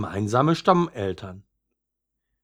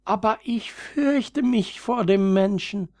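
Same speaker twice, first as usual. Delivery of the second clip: much higher, frightened little flower voice; timid young violet; 11 semitones higher than usual. Acted. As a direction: confess the fear in a small high voice. Younger violet afraid of humans. (ängstliches Veilchen mit hoher Stimme)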